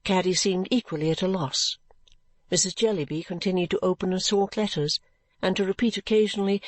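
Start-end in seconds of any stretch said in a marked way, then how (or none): none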